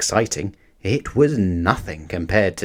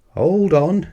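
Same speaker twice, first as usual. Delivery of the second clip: calling out; 7 semitones higher than usual. A narrator's reading. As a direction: call across to him. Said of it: "called his partner".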